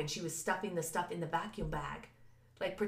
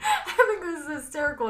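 higher, louder, through real laughter; laughing; 9 semitones higher, 7 dB louder than usual. Real, not acted. Host laughs at her own ambulance story, much higher and louder. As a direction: laugh the words out. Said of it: "I'm not smiling, by the way".

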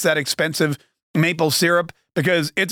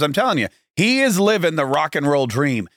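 normal talking voice

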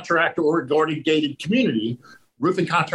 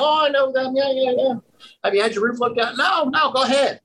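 much higher, eager homeowner voice; friendly homeowner; 10 semitones higher than usual. Retold or imitated. Homeowner welcoming the roofer he once turned away, cheerfully. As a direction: play the guy as suddenly welcoming.

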